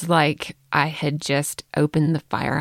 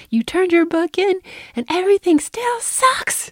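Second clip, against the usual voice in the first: put-on much higher, whiny teenage complaint; 14 semitones higher than usual. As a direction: squeak it out like a sulky kid. Daughter's complaint voiced.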